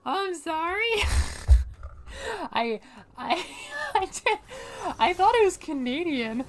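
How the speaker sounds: high, loud laughter breaking through the sorry; giggling helplessly while apologizing; laughing apology. Real, not acted. Streamer laughs loudly while apologizing to chat.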